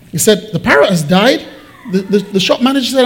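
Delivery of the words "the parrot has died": rising, incredulous echo; disbelieving question. Acted. News of the dead parrot echoed back, disbelieving. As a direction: repeat the news as a startled question.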